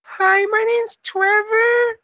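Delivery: put-on very high, slow, drawn-out child voice; cutest little kid voice. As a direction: imitate a little kid asking shyly in squeaky falsetto. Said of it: "like, the cutest little voices".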